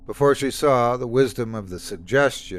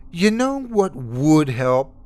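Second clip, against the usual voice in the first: slow, higher and louder, dripping sarcasm; thick sarcasm; 6 semitones higher, 6 dB louder, slower than usual. Acted. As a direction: drag it out mockingly. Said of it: "her voice thick with sarcasm".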